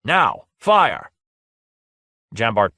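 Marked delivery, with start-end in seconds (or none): 0.0-1.1 loud command. A narrator's reading. Lord orders the weapon fired.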